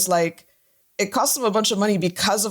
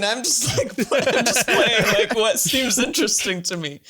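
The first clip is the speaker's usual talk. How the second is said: talking through giggles, slightly higher; laughing; 4 semitones higher than usual. Real, not acted. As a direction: laugh helplessly while explaining yourself. Laughs while defending own game choices.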